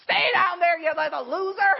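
mock scornful shout. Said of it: "look down on him and go".

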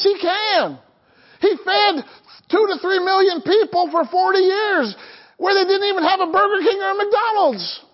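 high-pitched, emphatic pulpit delivery; fervent preaching. Real, not acted. Preacher assuring the congregation God can feed them, fervent preaching. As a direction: drive each point home high and insistent.

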